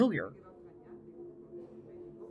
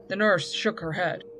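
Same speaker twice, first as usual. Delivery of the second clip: usual speaking voice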